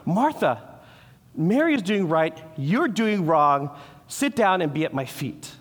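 the harsh way God does not speak to Martha; scolding rebuke. Retold or imitated. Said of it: "he doesn't do it saying".

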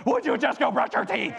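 a dad losing patience with his son, exasperated snap. Retold or imitated. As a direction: cut him off in exasperation.

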